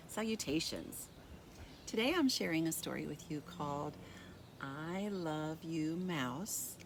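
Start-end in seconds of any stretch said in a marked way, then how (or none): none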